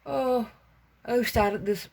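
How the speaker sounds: words stretched by a yawn. Real, not acted. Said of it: "pardon me for yawning".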